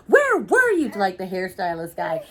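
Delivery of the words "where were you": put-on demanding girl. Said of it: "the girl is like".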